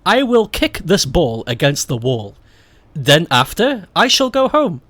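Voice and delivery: overly proper, crisp English; posh translation. Shown as a demonstration. Translating the dialect into stiff proper English. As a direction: read it like a formal textbook.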